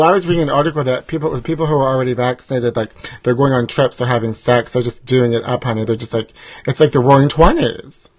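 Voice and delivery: ordinary delivery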